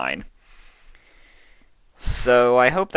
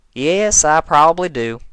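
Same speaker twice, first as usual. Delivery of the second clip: light Southern twang; 11 semitones higher, 10 dB louder than usual. Acted. Farmer mare answers.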